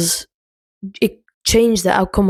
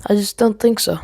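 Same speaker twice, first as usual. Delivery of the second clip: ordinary delivery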